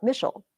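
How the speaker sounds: Michel stressed on the first syllable; wrong first-syllable stress. Shown as a demonstration. Teacher joking that it's not her name, first-syllable stress. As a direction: hit MICH in Michel, drop the ending. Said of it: "My second syllable is stressed".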